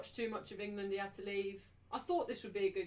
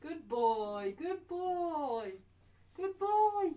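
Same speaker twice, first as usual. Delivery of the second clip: dog praise voice; 5 semitones higher, 4 dB louder, much slower than usual. Real, not acted. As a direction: cheer the puppy on, getting higher.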